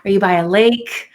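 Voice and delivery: ordinary delivery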